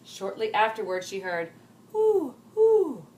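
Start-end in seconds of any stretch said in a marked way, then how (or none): 1.9-3.1 loud, high hooting call; owl hoot. Acted. Owl sound in story.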